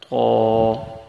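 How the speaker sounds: قا said thick and full with a round mouth; letter demo. Shown as a demonstration. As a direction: give قا a heavy, full sound. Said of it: "ایک الف کے برابر کھینچ کر".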